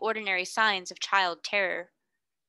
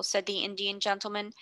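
usual speaking voice

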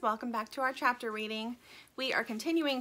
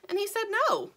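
little bird voice; 12 semitones higher, 4 dB louder than usual. Acted.

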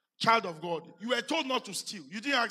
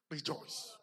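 whispered; 7 semitones lower, 11 dB quieter than usual. Acted. Preacher whispers Paul's command to the congregation, whispered. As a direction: breathe the word Rejoice as a whisper.

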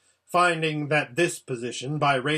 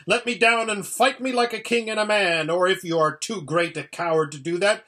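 high, loud howled demand; humiliated prince howling up high; howling challenge; 7 semitones higher, 5 dB louder than usual. A narrator's reading. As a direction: howl it in outraged defiance. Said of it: "howled Rabadash".

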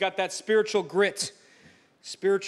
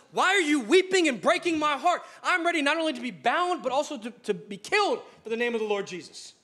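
impassioned declaration; 6 semitones higher than usual. Acted. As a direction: proclaim it like Paul refusing to turn back. Paul answers weeping friends.

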